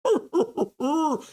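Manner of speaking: high cracking laugh, almost tearful. Real, not acted. Host laughing at a chat joke, high cracking laugh. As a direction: laugh up high until it nearly cries.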